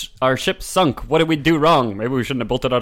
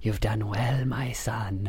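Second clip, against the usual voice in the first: softer hushed villain whisper; 4 semitones lower, 9 dB quieter, much slower than usual. Pretend. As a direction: breathe it like a sly villain.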